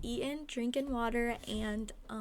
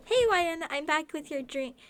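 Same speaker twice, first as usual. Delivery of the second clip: high, loud and chirpy; Butters voice; 6 semitones higher, 9 dB louder than usual. Acted. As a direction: bounce in cheerfully. Butters returns cheerfully.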